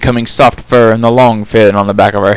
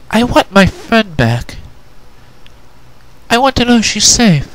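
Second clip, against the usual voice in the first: Twilight, pained; 12 semitones higher than usual. Acted.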